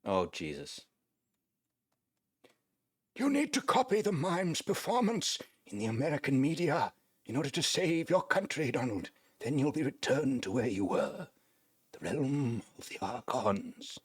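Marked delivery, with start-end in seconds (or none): 3.2-14.0 higher, otherworldly quest giver; lightly voiced mystical guide; quest NPC voice. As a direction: deliver the quest like a mystic oracle, slowing at the end. Reads quest NPC dialogue.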